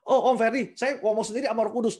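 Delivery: lightly voiced congregant, defensive; mock youth excuse. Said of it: "kalau kalian bilang". (jemaat muda membela diri kepada Om Ferdi, agak keras)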